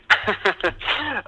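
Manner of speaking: bright high-pitched laugh; a burst of high laughter. Real, not acted. Laughs at own accent joke.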